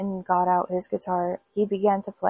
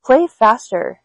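loud urging; 2 semitones higher, 8 dB louder, slower than usual. Acted. Widow urges fiddler on.

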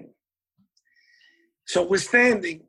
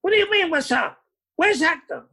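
much higher, mock exasperated boss demanding answers; indignant boss; 11 semitones higher than usual. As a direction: play the annoyed club owner asking where Hector is. Club boss demanding the missing bandleader.

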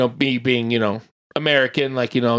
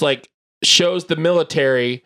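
ordinary talk

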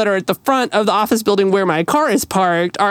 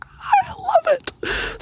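much higher, slow, softer squeal of delight; gushing; 4 dB quieter, much slower than usual. Retold or imitated. She gushes over the fireman fixing her car alarm, high squeal. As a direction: squeal it like you could cry with joy. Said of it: "I was like".

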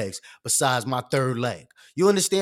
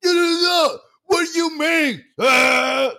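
high, loud screaming outburst; mock yelling; 12 semitones higher, 7 dB louder, much slower than usual. Mocking the idea of yelling at a comment, slow high scream. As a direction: scream in a drawn-out mock rage. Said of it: "just be yelling at a comment".

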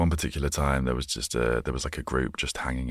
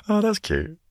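squeaky high and louder in delight; 4 dB louder than usual. Real, not acted. Pleased with his made-up children's rhyme, squeals with delight. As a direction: gush over your own rhyme. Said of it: "delighted at own rhyme".

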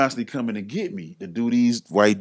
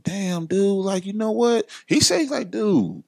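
much higher, admiring quote; impressed praise; 13 semitones higher than usual. Retold or imitated. Johnny telling his friend he cleaned up his image, high and impressed. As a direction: sound genuinely impressed.

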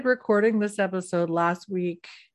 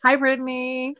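mock greeting; 4 semitones higher than usual. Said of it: "that's how I sounded".

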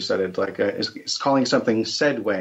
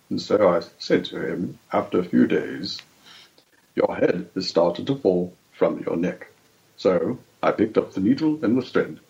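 Christopher Lee impression; 2 semitones lower than usual. Podcast guest showing off his English accent, grand old actor impression. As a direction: settle down into a grave, deliberate register. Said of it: "sounds sort of like Christopher Lee".

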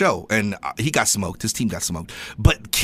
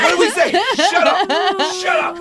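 high laughing yell, hammed up; mock shout laughing; 3 dB louder than usual. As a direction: shout through laughter, mocking. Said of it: "They're like".

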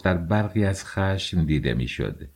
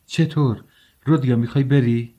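higher, worried asking; anxious question; 5 semitones higher than usual. A narrator's reading. Mother learns her son is leaving, anxious. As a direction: ask in mild alarm. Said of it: "پولشریا با اضطراب پرسید".

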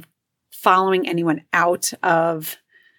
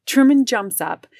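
ordinary talk

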